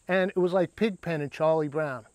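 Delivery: usual speaking voice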